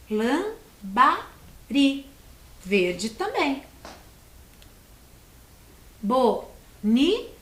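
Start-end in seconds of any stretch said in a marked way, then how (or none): 0.1-2.0 Lambari split as LAM-BA-RI, very slow; syllable by syllable. Shown as a demonstration. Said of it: "Três sílabas". 6.0-7.3 Bonito split as BO-NI-TO, slowly; syllable by syllable. Shown as a demonstration. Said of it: "Três sílabas".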